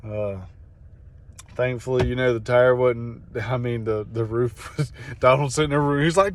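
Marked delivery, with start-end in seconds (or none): none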